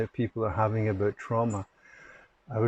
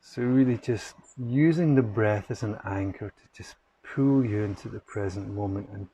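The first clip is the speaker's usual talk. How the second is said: guided meditation. Acted. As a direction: gently guide listeners into the breath, unhurried.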